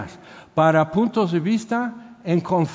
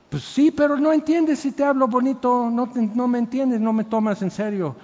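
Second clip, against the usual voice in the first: the coach's retort; 6 semitones higher than usual. Retold or imitated. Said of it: "y él me dice".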